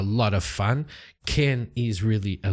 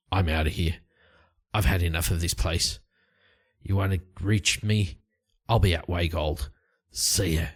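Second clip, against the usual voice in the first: lower, fed-up voice walking away; gruff farewell; 4 semitones lower than usual. Acted. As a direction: brush them off and leave. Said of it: "And Light goes".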